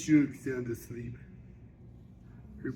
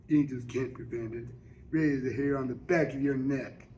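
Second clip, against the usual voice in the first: plain reading voice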